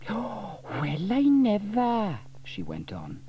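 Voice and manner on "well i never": shocked gossip. A narrator's reading. Dilys shocked spying.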